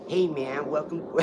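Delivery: big, loud mock American; American accent. Shown as a demonstration. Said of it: "do your best American accent".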